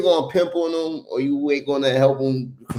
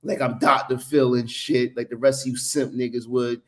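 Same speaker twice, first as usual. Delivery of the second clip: ordinary talk, nothing marked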